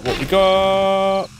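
"What we got?" drawn out slowly and high; sing-song stretch. Pretend. Slow stretched repeat, higher.

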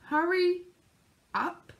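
drawn-out, word by word; slow reply. A narrator's reading. Mister Slow answering Mister Busy, slow. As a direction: drag it out lazily. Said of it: "replied Mister Slow".

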